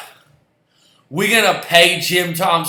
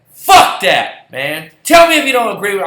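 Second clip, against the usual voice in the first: fast, higher, louder, fired-up demand; real anger; 4 semitones higher, 7 dB louder than usual. Real, not acted. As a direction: spit it out fast and heated. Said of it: "I'm sorry to get this angry".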